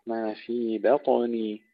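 بطني with a clear echoing bounce on the طاء; qalqala demo. Shown as a demonstration. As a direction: stretch بطني slowly, light باء, heavy bouncing طاء. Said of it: "ونقلقل الطاء المفخمة". (قلقلة الطاء في بطني)

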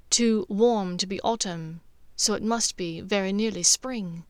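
usual speaking voice

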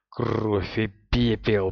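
slow growled curse. Acted. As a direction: growl it through the teeth. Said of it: "прорычал Мэтт".

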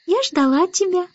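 put-on high little-girl voice; baby Annabel, expectant. A narrator's reading. Baby Annabel has awaited the starling, high child voice. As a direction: speak high, like a small child.

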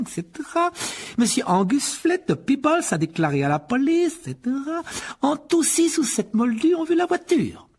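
très aigu, lent, appuyé; lecture méprisante. A narrator's reading. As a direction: lire l'article avec dédain. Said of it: "Il commença à lire l'article à haute voix". (lecture à voix haute dédaigneuse)

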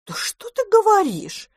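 very high astonished squeal; cat squeals in astonishment at the house-spirit's tale. Acted. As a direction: gasp it out in amazement. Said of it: "удивилась кошка".

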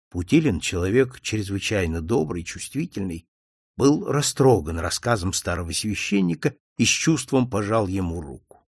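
ordinary delivery, nothing marked

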